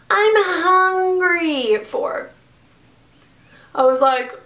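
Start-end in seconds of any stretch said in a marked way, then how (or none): 0.1-2.3 higher, slow whiny "I'm hungry"; mock whine. Quoting the roommate's whining back at her. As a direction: stretch it out in a mocking whine. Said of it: "what the fuck are you screaming".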